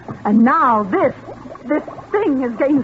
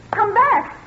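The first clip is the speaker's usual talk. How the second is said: high, calling out after him; calling him back as he walks away; desperate calling; 12 semitones higher than usual. Acted. Wife calls after leaving husband.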